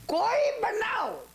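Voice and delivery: high, slow, drawn-out cry on કોઈ બનાવ. Real, not acted. Preacher warning the congregation, high slow cry. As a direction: wail the words to shake listeners. Preacher hammers a key point.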